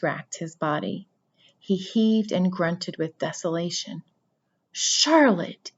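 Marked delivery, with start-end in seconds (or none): 4.7-5.7 moaned. A narrator's reading. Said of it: "he moaned".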